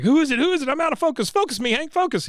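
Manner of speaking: high, urgent squawk; high-pitched, agitated character voice; Dale. Retold or imitated. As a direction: imitate a frantic man who can't get focus. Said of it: "And Dale's like".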